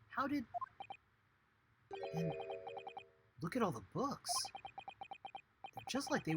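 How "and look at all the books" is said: usual speaking voice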